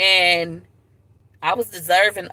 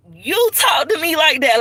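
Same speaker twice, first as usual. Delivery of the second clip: higher and louder, throwing it back at mom; retorting back; 8 semitones higher, 4 dB louder than usual. Retold or imitated. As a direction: fire the accusation straight back. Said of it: "And I'm like".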